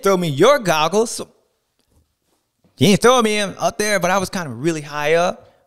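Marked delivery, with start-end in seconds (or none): none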